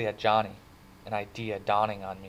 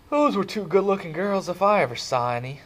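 admiring leer; 10 semitones higher, 6 dB louder than usual. Acted. Two-Bit admiring the girls.

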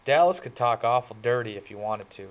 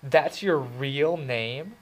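higher, slow incredulous question; higher slow mock disbelief; 5 semitones higher, 4 dB louder, much slower than usual. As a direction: doubt the name, amused. Remarks I usually get.

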